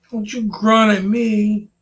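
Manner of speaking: high, loud telling-off of the dog; sharp scolding. Real, not acted. Owner tells off the grunting dog, high and loud. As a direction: snap at the dog to quit grunting.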